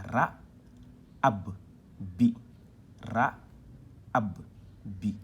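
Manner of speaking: "ra, ab, bi" in firm separate syllables; doubled-letter demonstration. Shown as a demonstration. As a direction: split the double b across "ab" and "bi". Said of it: "quand on dédouble". (syllabes détachées, lettre dédoublée)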